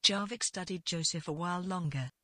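usual speaking voice

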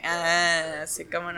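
low, quiet sarcastic brush-off. Retold or imitated. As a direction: say it dry and sarcastic. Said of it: "es de que".

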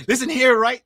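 put-on teasing Bajan accent; mock Bajan accent. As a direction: call it out in a playful Barbadian lilt. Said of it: "If I could do the accent".

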